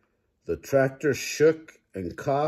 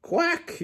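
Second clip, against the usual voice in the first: very high quack; yelled quack. A narrator's reading. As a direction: squawk a duck quack up high. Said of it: "yelled Duck".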